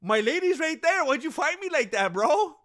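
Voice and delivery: high whiny mock plea; teasing the loser as if he were crying to Roundtree. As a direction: whimper like a sore loser. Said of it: "he's basically crying to him".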